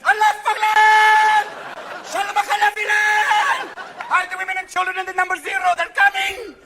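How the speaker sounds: high, loud, long-held call; hammed-up frantic wail; wailing cries of alarm. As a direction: shout it out with stretched syllables. Cries across the Middle East.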